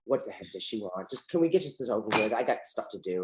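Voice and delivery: grumbling inner voice. Retold or imitated. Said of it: "and I'm like".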